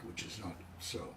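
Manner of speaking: ordinary delivery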